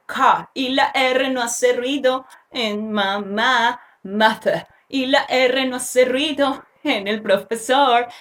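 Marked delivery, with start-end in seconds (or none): 0.1-0.5 "car" in a British accent with the final R dropped, like "cah". Shown as a demonstration. Teacher switching to a British "car" to show the silent R. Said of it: "Ahora con acento británico". 4.0-4.7 British accent. Shown as a demonstration. Teacher showing learners a British "mother" after the American one. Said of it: "Ahora con acento británico".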